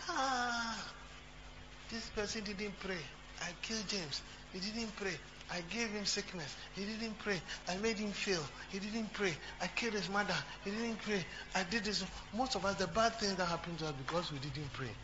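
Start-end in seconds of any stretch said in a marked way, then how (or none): 0.1-12.0 higher, softer voice for Satan; gloating Satan. Acted. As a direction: play Satan counting his victims, softly and higher. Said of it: "And Satan has seen".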